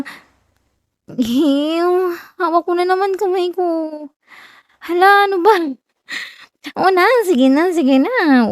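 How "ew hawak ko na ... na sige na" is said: high flustered protests; flustered squeal. Acted. Girlfriend giddy as her boyfriend grabs her hand, high pitched. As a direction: squirm and give in, giddy.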